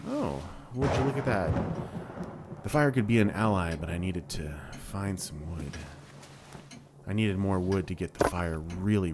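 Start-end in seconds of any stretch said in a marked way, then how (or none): none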